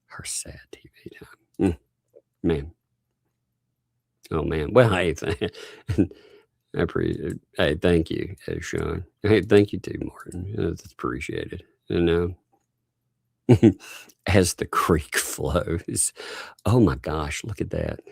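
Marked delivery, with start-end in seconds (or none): 0.1-2.7 soft, hushed whisper of fake grief; mock-mournful whisper. 14.3-16.1 slow, dramatic soap opera title read; drawn out like a TV drama announcer. Pretend. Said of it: "great soap opera".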